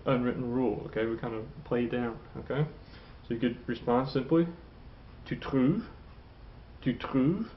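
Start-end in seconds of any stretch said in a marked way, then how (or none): 5.2-7.5 "trouves" lifting into a question; rising intonation. Shown as a demonstration. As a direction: ask "Tu trouves?" with the pitch climbing on "trouves". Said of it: "Intonation rising".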